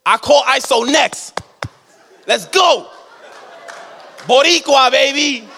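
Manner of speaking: high, loud cheering for his brother; hype cheer. Retold or imitated. Big brother cheering on the court, high and loud with a laugh. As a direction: fire up the little brother. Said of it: "he's like".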